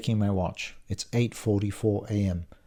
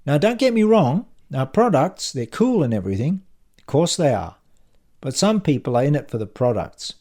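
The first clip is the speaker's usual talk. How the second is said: higher, louder colleague's sales pitch; punchy, raised salesman voice; pushy salesman colleague; 5 semitones higher, 6 dB louder than usual. A narrator's reading. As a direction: pitch it hard to a workmate. Acts Brad, pushy colleague.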